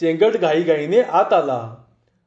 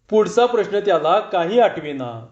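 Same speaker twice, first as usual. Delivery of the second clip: ordinary delivery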